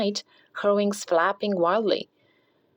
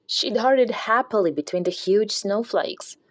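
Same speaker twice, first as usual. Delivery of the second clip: plain reading voice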